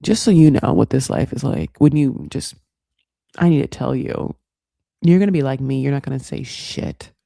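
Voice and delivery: lightly voiced Dev laying down the law; imitating Dev. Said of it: "He's like".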